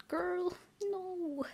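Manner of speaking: high, soft despairing; soft dismay. Real, not acted. Player despairing as her character is hurt. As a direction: plead softly.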